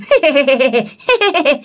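high, put-on strange giggle; eerie imitated cackle. Mysterious woman laughing after warning of demons, eerie high cackle. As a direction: mimic a creepy woman's cackle. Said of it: "Bà cười vậy đó".

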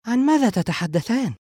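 high, puzzled woman's question. A narrator's reading. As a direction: ask with a high, curious lift. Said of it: "سارة بتساؤل". (تساؤل بصوت نسائي عال)

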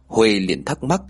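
plain reading voice

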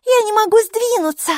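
loud, very high-pitched complaint; high cry. A narrator's reading. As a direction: cry out in a squeaky voice that you are stuck. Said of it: "снова закричала Майки".

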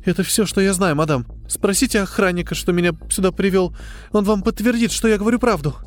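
high-pitched, anxious account; trembling fear. A narrator's reading. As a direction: plead to be believed by a feared boss. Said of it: "дрожащим голосом ответила Линда".

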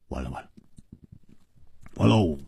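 low muttered resignation; exhaling, deflated. A narrator's reading. 胡铁花 shaking his head at being outdone, deflated. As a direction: mutter it low, as if all is lost. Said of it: "长长的吐了一口气， 摇着头说".

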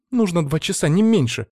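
lightly voiced demanding girl. A narrator's reading. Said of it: "потребовала Алиса".

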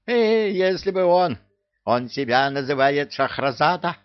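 high, animated bird voice; excitable parrot character. Acted. Parrot telling Кевин the old man's pen name, high and indignant.

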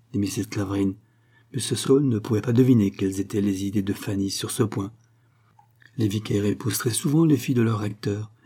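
ordinary delivery

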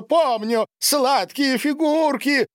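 high, drawn-out squeal of delight; gleeful outburst. A narrator's reading. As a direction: revel in a delicious memory. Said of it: "расхохотался". (восторженный высокий протяжный возглас)

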